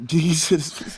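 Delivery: laughing out the word; cracking up mid-word. Real, not acted. Laughing at friend's joke.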